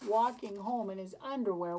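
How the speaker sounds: usual speaking voice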